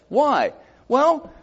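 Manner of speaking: ordinary delivery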